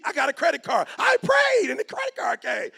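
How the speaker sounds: high, loud, mocking voice of a naive believer; hammed-up gullible churchgoer bragging.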